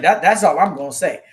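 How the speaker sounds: loud imitated last word on it; mock stern finality; serious tone. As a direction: retell it firmly and loudly. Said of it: "You've never used that tone with me before".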